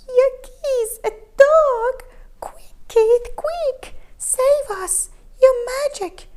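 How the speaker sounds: high, loud panicked squeal. A narrator's reading. Cats panicking at the dog, begging Keith. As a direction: shriek for help in panic. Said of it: "The cat squealed in panic".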